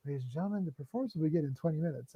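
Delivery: understated sheepish announcer; mock meek announcement. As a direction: murmur the delay sheepishly. Second announcement.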